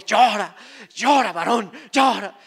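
loud, insistent "llora, llora, varón"; imitating church folk pushing him to weep; mock urging to cry. Retelling pushy churchgoers, loud mock urging.

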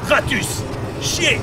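sudden high yell at the rat; startled shout. Real, not acted. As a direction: blurt it out in alarm.

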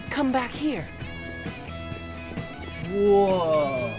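ordinary talk

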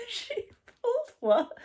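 high puppet squeak in disbelief; squeaky puppet voice. Acted. Puppet asks its owner what she pulled, high and squeaky.

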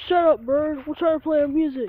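loud annoyed yell. Real, not acted. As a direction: snap at the bird. Yelling at a bird.

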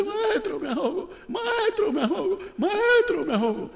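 gritos muy agudos de desesperación; pánico. Acted. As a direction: pide ayuda chillando mientras se hunde. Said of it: "él se asusta". (grito agudo de quien se ahoga)

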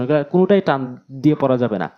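ordinary talk, nothing marked

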